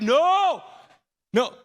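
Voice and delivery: high, loud shouted no, trying to stop him; frantic no. Retold or imitated. Father yelling at his burned son, frantic shout. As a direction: yell at him to stop rubbing his hands.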